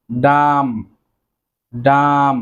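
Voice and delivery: ডাম said slowly and clearly, with the final b silent; careful pronunciation. Shown as a demonstration. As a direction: model the word ডাম for the class. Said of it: "Then রয়েছে".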